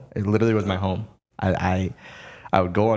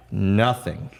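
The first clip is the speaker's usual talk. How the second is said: normal talking voice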